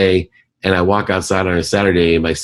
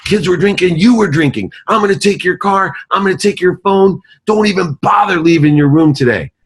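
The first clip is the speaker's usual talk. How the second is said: higher-pitched mock rant, punishing; hammed-up furious dad laying down punishments; furious father; 12 semitones higher, 3 dB louder than usual. Father yelling punishments at his daughter, hammed-up fury.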